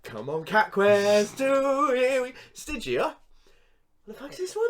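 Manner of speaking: slow eager build to high shocked squeak; hopeful then bewildered, rising high; excited then baffled. Retold or imitated. Excited then baffled game launch.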